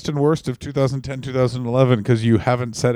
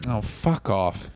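fed-up dismissal; 2 semitones lower, 6 dB quieter, much slower than usual. Retold or imitated. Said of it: "And you're like".

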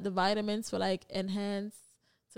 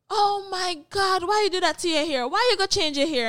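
put-on upset old Jamaican man; 7 semitones higher, 6 dB louder than usual. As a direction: imitate the old man scolding about the hair. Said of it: "he said".

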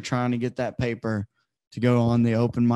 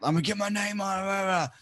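higher, quicker mock eagerness; mock eager comic; 6 semitones higher, 6 dB quieter, faster than usual. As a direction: mimic the pushy comics. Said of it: "to, like".

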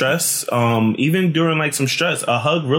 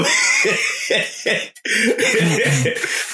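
long laugh; 7 semitones higher than usual. Real, not acted. Long laugh after apology.

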